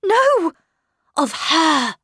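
high, loud, emphatic denial; urgent correction. A narrator's reading. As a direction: correct her with alarm. Governess urgently corrects housekeeper.

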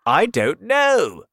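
high, loud, slow voice; old grasshopper. A narrator's reading. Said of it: "the old green grasshopper said".

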